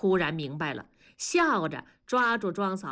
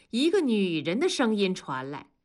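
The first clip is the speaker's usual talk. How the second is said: usual speaking voice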